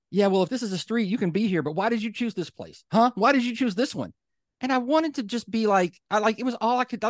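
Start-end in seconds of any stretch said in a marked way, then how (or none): 0.1-4.1 high, exasperated mock rant; mocking angry neighbour. Imitating the neighbour railing at the woman on the street, high and exasperated. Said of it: "And then he just says".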